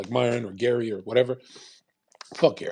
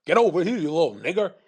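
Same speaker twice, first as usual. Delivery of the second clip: imitating a yell, higher and louder; 5 semitones higher, 4 dB louder than usual. Imagined ancestor chasing a man, hammed-up yell. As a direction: bark it like a man chasing someone down. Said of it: "chased down a Filipino ... and said".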